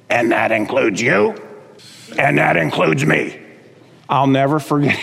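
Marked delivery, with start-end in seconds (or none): 0.1-3.3 put-on borrow an old preacher's worn voice; imitating Doctor DeHaan. Pastor quoting an old radio preacher, mock gravelly voice. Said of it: "incredibly abused, gravelly voice".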